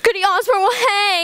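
high, crying outburst of dread; tearful cry. Acted. Mary tells the Proctors through tears.

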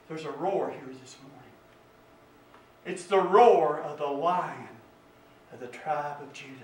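low, soft voice with long pauses; hushed preaching. Real, not acted. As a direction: hush the congregation to listen.

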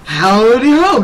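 high, loud cartoon call, gliding upward; Christmas poo greeting. Streamer greeting viewers as requested, hyped cartoon voice. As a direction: sing out a hyper South Park style greeting. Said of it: "like the Christmas boo in South Park".

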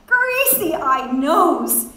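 loud, high, slow jeering cry; gleeful drawn-out yell; splendid yell. A narrator's reading. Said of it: "gave full vent to splendid yell".

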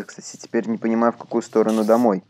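ordinary talk

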